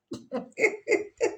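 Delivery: bursts into a high laugh; amused high laugh. Real, not acted. Reacting to her own remark.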